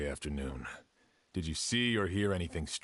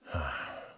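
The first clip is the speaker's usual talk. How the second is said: a soft, tired sigh; weary sigh; 4 semitones lower, 4 dB quieter than usual. Acted. Before taking the grieving brother's statement, a weary sigh. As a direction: let out a heavy breath before deciding. Weighing a hard duty.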